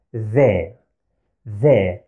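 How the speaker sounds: the said with the tongue out, as in that; voiced th with the tongue between the teeth; Arabic thal. Shown as a demonstration. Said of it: "like the English sound T H as in that".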